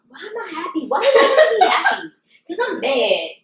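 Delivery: high-pitched mock indignation; hammed-up huffy complaint; mock mad. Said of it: "sometimes I'll be mad".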